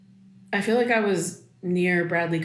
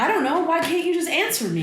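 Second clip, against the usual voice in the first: high, strained and accusing; frustrated daughter pleading with her mom, high and tense; upset pleading; 10 semitones higher than usual. Acted. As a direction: push back at her mother, voice pitched way up. Daughter argues with her mother.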